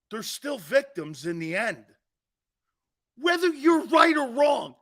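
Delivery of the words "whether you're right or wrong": high shouted outburst; angry shout. Real, not acted. Host rants at the commissioner, high shout. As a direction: blast the point.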